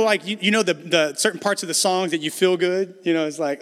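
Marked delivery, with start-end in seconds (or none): none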